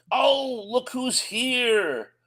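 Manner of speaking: playfully surprised to spot a friend; delighted surprise. Real, not acted. Spotting a viewer arriving.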